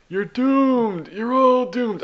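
high, eerie doom-prophet wail; slow ominous raspy drawl. Retold or imitated. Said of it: "there's a guy that just goes".

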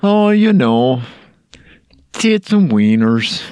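silly exaggerated Fargo accent on request. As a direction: give the catchphrase a mock Fargo lilt. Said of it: "you wanna give it a go?".

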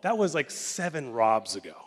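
loud, playful brush-off; big playful "oh", then easing down. Retold or imitated. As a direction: imitate someone lightly waving away the past. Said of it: "he does it, like, playfully".

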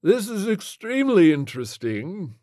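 high, fussy gentleman; dazed prim gent. Acted. Flintlock voiced.

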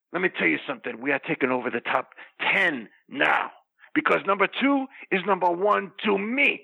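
low, intense wrestler promo voice; wrestling promo. Acted. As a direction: cut a heel promo, low and menacing. Wrestling promo character rant.

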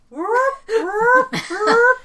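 high loud whooping siren; alarm siren. Retold or imitated. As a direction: wail it like a ship's alarm. Mimicking ship alarm siren.

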